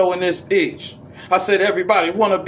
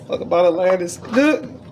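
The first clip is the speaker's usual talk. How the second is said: ordinary delivery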